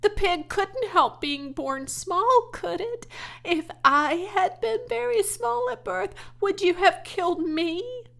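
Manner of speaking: high, loud pleading; crying out. A narrator's reading. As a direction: argue through rising tears. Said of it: "cried Fern".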